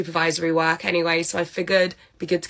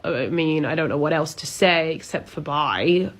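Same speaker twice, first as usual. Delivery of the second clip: ordinary talk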